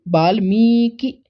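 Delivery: वाल्मीकि said slowly, with a long मी and a short कि; वाल्मीकि pronounced slowly for the class; slow careful pronunciation. Shown as a demonstration. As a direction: stretch the मी in वाल्मीकि, then clip the कि. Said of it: "मी दीर्घ होता है, कि ह्रस्व".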